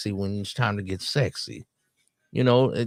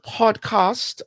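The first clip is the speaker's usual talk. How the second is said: high, drawn-out British-style podcast; imitated British accent; 11 semitones higher than usual. Reactor replaying the word he likes, imitated British accent. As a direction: mimic her British vowels, very slow and high. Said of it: "I like the way she say that".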